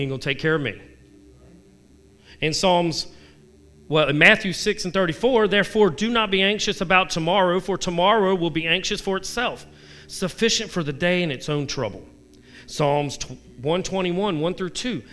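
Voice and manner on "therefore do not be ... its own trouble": raised, earnest Bible reading; preacher reading a psalm; scripture reading. Acted. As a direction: proclaim the psalm.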